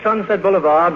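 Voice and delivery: ordinary talk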